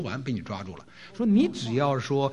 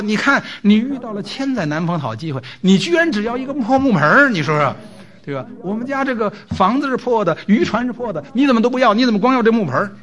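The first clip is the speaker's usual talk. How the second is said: much higher and louder berating; shrieking demand for more, pitch climbing; angrier wife; 7 semitones higher, 6 dB louder than usual. Retold or imitated. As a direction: scold as the wife, even more outraged. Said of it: "太太更生气了".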